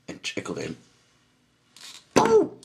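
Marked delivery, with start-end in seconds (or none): 2.2-2.5 very high, loud yelp; alarmed scream. A narrator's reading. Mister Grumpy is suddenly tickled, screaming in alarm. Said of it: "screamed Mister Grumpy in alarm".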